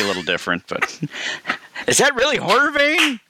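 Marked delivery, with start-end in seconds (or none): 1.9-3.2 put-on high, slow creaky old-timer; old man voice. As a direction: play a doddering old man. Acting as old podcasters.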